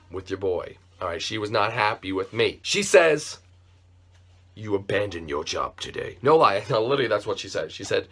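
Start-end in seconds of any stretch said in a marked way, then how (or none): 4.5-6.2 low, softer sea-witch voice for the boss. Retold or imitated. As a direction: drop the voice low and cold like a sea witch, much lower and softer. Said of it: "in full Ursula Sea Witch mode".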